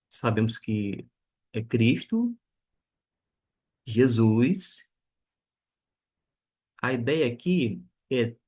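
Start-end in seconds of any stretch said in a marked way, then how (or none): none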